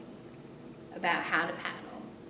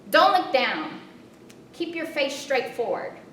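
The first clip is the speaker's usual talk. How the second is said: loud, higher calling out; yelled; 6 semitones higher, 7 dB louder than usual. Retold or imitated. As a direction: shout the instruction across the water. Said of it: "she yelled from behind me".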